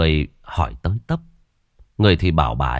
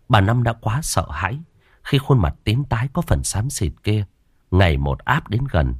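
plain reading voice

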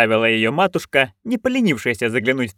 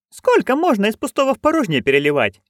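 put-on higher, eager old crone; Nanny Ogg voice; 11 semitones higher than usual. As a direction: pitch up into a gleeful old busybody. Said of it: "с досадой встряла нянюшка". (высокий азартный голос старой ведьмы)

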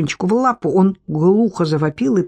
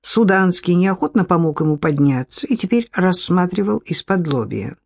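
ordinary delivery

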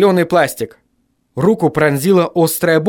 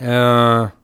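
drawn hesitant filler; 3 semitones lower than usual. A narrator's reading. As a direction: falter before answering. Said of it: "замялся я".

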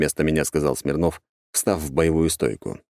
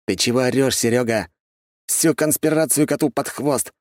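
higher-pitched, cross reprimand; irritated scolding; 6 semitones higher than usual. A narrator's reading. Boss scolds his man for yelling the code, annoyed cursing. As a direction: scold a careless underling. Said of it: "выругался Смирнов".

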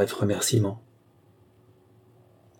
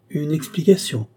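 higher-pitched, surprised question; 6 semitones higher than usual. A narrator's reading. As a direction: echo it back in surprise, pitched up.

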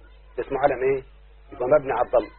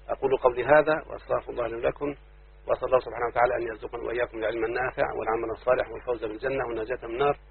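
soft, quieter closing supplication; closing prayer; 3 semitones lower, 7 dB quieter than usual. Acted. Teacher ending the lesson with a prayer, soft and devout. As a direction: close the lesson with a gentle prayer.

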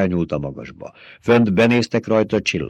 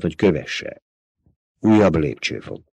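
usual speaking voice

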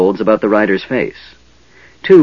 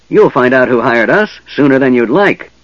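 loud, raised snarl of menace; snarled threat; 5 semitones higher, 5 dB louder than usual. Acted. Goblin threatening the distracted half-elf, snarling. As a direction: threaten him through your teeth. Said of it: "snarled another goblin".

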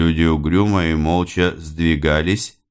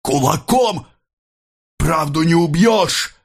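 high-pitched, forceful cry; defiant cry; 11 semitones higher than usual. A narrator's reading. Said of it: "крикнул Рыбин". (дерзкий, высокий выкрик)

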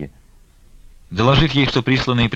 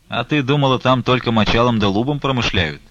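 ordinary delivery

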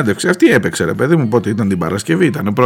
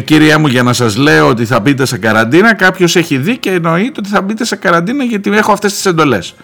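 worked-up retelling of a military order-giver; military official; 5 semitones higher than usual. Retold or imitated. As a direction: lay down orders like an army officer. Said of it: "μου απαντά με στρατιωτικό αυτό".

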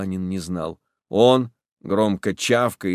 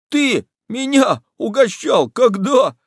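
high, loud indignant surprise; suddenly animated; 13 semitones higher, 5 dB louder than usual. Acted. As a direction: perk up and challenge him. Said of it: "Араб вдруг оживляется".

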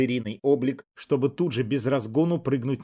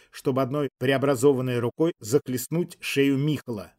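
plain reading voice

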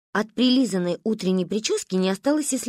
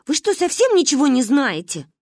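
high, louder disbelieving scold; scornful; 6 semitones higher, 5 dB louder than usual. A narrator's reading. Girl scornfully scolds the ignorant girls.

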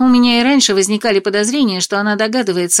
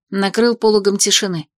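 usual speaking voice

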